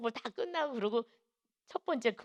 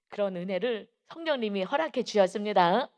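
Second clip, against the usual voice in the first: ordinary talk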